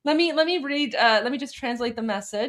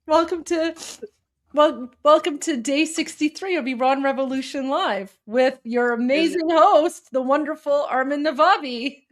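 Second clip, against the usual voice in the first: announcer voice, a little higher and stretched on host; mock announcer intro; 4 semitones higher than usual. Host opening the livestream for the audience, playful announcer voice. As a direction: hype up the show opening like a TV host.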